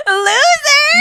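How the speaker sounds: high, very loud jeering taunt; mocking shout. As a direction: hurl a hammed-up insult. Acted taunt at rejected boy.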